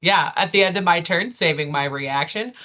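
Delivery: normal talking voice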